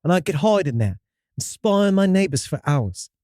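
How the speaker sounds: storyteller in a put-on British accent; hammy English-accented first-person tale. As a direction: read it with an amateur British accent. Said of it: "Can you stick with this accent".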